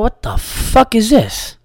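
mock horror at the souvenirs; drawn-out incredulous squawk; baffled outrage. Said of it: "So I go".

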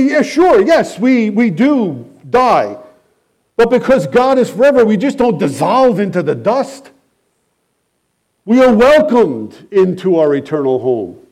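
preacher answering the mourner, impassioned; fervent reply. Acted. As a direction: answer with rising fervor. What he wanted to reply.

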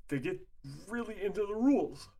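words stretched by a yawn. Real, not acted. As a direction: talk through a yawn. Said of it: "I'm yawning left and right here".

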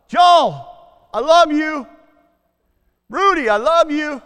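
father shouting love across the house; yelling to the kids. Retold or imitated. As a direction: call out each child's name with joy. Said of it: "I will literally yell ... at the top of my lungs".

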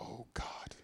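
unvoiced, dreading whisper; dismayed whisper. Whispering dread as the bishop asks to talk. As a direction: breathe it out in dread.

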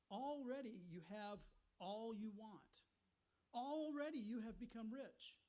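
loud, drawn-out mock admiration; sarcastic. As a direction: inflate it slowly with heavy sarcasm. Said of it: "This is what is referred to as sarcasm. He is being sarcastic".

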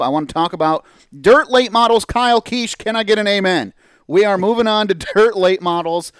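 loud, pumped-up radio host intro; hype announcer. Acted. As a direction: fire up the crowd like a ring announcer. Host hypes the next segment.

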